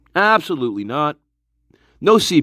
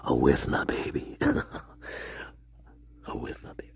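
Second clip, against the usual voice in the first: hushed whisper greeting a viewer; 13 semitones lower, 13 dB quieter than usual. Real, not acted. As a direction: breathe the name out in a hush.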